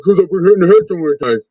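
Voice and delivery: put-on slurred, punch-drunk old wrestler. Wrestler mimicking battered old-timers he heard when younger, slurred old-man voice. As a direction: imitate an old wrestler, words running together and slurred. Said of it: "I would hear old wrestlers be like".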